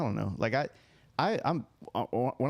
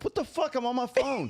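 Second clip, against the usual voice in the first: high-pitched outraged disbelief; indignant outraged yelp. Retold or imitated. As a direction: squeal it out, scandalized. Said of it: "I'm like".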